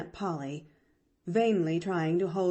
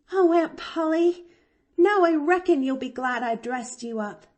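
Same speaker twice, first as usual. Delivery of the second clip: delighted; 8 semitones higher, 7 dB louder than usual. A narrator's reading. Delighted child to aunt.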